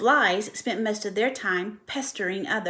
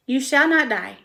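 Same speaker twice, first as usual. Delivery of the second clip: brisk reassurance; 4 semitones higher, 6 dB louder than usual. Acted. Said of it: "said Charlotte briskly".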